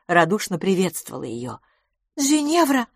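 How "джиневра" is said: high, anxious call of a name; worried. A narrator's reading. Miss LaFosse questions her friend, worried. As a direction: call out in alarm, high-pitched. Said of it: "вопросила мисс Лафос обеспокоенно".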